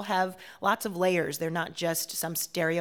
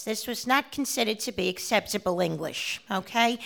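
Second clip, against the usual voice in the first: put-on old New York accent; 3 semitones higher than usual. Performer showing the host an accent once looked down on, old New York accent. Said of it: "if you talk like this".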